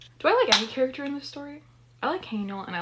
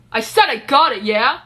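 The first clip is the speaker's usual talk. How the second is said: exasperated retort; 2 semitones higher, 11 dB louder than usual. Acted. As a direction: snap back loudly, fed up. Character snaps back at the warning.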